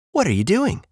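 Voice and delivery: high-pitched curious sheep; high sheep character voice; friendly sheep. Acted.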